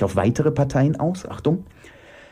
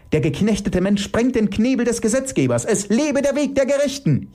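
high, agitated rallying cry; 12 semitones higher than usual. Acted. Elke proclaiming the path of the just, agitated. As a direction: proclaim it fervently, high-pitched. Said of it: "entgegnet Elke erregt".

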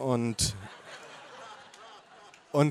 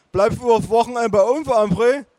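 much higher, louder pushy voice; nagging relative; 9 semitones higher, 6 dB louder than usual. Acted. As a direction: press the question insistently. Family members nagging the narrator.

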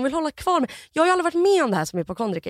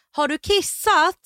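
slow, higher imitated nurse; spelled-out, pitched-up question; 6 semitones higher, slower than usual. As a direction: ask slowly as to someone who understands little.

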